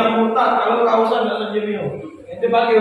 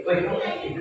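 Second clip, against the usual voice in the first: much softer, with a rising end; different, gentler intonation; 8 dB quieter than usual. Shown as a demonstration. Preacher showing how intonation changes the same words, softly. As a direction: say it quietly, lifting the last word.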